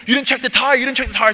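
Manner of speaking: loud, high, frantic accusation; mock screaming. Trainee to his guy after the blowout, mock screaming. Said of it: "he starts screaming".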